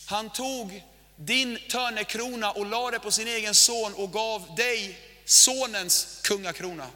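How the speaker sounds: slow, weighty preaching cadence. Acted. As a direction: preach each line slowly, with weight. Preaching over music. (långsam, tung predikoton)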